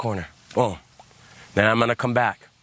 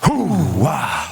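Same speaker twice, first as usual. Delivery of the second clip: hoo-wah pushed out on the breath, louder; breathy chant; 2 semitones lower, 4 dB louder than usual. Shown as a demonstration. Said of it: "Now we're gonna add the breath".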